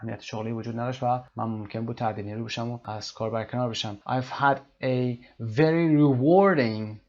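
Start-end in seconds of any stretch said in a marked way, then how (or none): 4.1-7.0 rewarding pushed louder on its middle syllable, slowly; slow teaching read, stressing rewarding. Shown as a demonstration. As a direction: hit the war of rewarding. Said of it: "rewarding ببینید قسمت".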